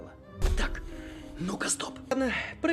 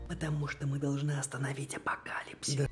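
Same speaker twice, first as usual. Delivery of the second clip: breathy unvoiced whisper; 6 semitones lower, 6 dB quieter than usual. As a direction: whisper it close. Whispered aside.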